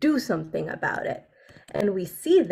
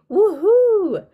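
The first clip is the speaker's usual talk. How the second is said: high, loud cheering woohoo; excited woohoo; 10 semitones higher, 8 dB louder than usual. Shown as a demonstration. Teacher shows an excited cheer. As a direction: whoop it out like a happy kid. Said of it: "Maybe we want to say".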